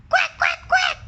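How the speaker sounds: high, loud duck quacks; excited quacking. Acted. Ducks quacking as the man reaches, high and loud.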